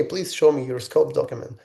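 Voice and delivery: usual speaking voice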